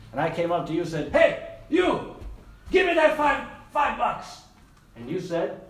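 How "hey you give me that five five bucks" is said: bad guy. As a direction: act out a robber accosting a victim. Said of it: "I'm gonna be the bad guy".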